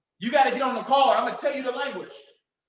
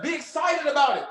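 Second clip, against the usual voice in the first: ordinary talk, nothing marked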